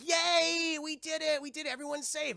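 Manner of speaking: high cheer, loud then easing off; mock celebration. Retold celebration.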